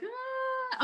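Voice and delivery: soft, high held squeak; high startled "ah". Retold or imitated. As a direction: let out a small, high "ah". Mimics reaction to being on camera.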